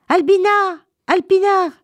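loud, high-pitched call; ostrich crying out. Acted. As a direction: shout the name high, as if calling across a crowd. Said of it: "cria l'autruche". (cri aigu de l'autruche)

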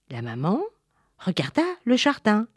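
ordinary delivery, nothing marked

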